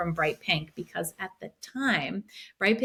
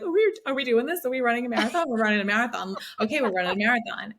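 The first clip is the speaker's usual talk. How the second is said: high disbelief settling into giddy certainty; retold giddy hysteria; 5 semitones higher, faster than usual. Sisters realizing they signed up, giddy hysterical disbelief. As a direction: replay two sisters hyping each other up. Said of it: "hysterical. We're, like, laughing, crying".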